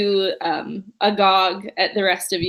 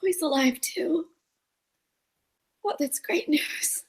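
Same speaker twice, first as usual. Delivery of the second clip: soft, slow whispery rasp; raspy whisper of relief; 6 semitones higher, 5 dB quieter than usual. Acted. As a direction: breathe it out in a hushed rasp. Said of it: "voice is kinda raspy".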